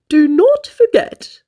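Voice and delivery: slow, pompous manager; a hint of a self-important boss. Manager warns the new waitress importantly. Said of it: "in a very important voice".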